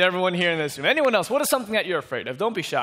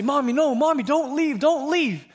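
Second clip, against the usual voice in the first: put-on high, whiny little-kid begging; acted panicky child calling mommy back; pleading frightened child; 9 semitones higher, 4 dB louder than usual. Child begs mother not to leave.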